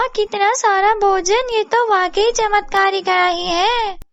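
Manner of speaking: high, gloating voice with stretched endings; greedy, gleeful neighbour. Acted. Babli gloats over stolen kadhai and feast, high gleeful voice.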